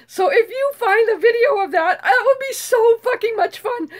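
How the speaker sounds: excited. Real, not acted. Eagerly begging for video.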